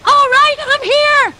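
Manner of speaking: loud, high call to the house. Acted. Boy arriving at uncle's house, calling out loudly. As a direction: announce yourself loudly on arrival.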